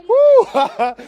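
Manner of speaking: a high, loud, delighted whoop broken by laughter; a winner's whoop of pure joy, laughing; ecstatic whoop. Real, not acted. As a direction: let out a joyful victory whoop. Celebrating his win, laughing.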